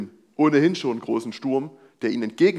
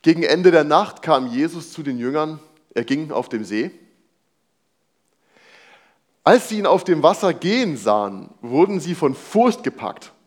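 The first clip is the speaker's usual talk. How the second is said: scripture reading; 2 semitones higher, 4 dB louder than usual. Acted. Reads the Bible passage aloud.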